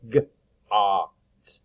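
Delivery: "g, ah, t" split into sounds; sounding out got. Shown as a demonstration. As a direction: break "got" into g, ah, t. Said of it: "It is the short O sound".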